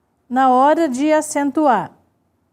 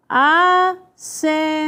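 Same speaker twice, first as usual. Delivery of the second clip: "Assembleia" very slowly as a, sem, blei, a; syllable by syllable; much slower than usual. Shown as a demonstration. Teacher dividing the word for students, "Assembleia" syllable by syllable. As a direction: stretch each syllable of "Assembleia" separately. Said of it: "Vamos dividir em sílabas".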